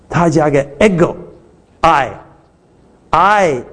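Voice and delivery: ego and I said very slowly and apart; I drawn out to show the added ego; stressing ego. Shown as a demonstration. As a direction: lean on ego and I, very slow. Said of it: "不需要这个ego".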